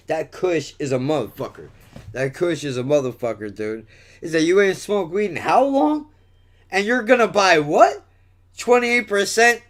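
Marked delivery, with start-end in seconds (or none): 4.4-9.6 higher-pitched mock disbelief; mock incredulous. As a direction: retell it as a disbelieving challenge. Retold incredulous questioning of his purchase.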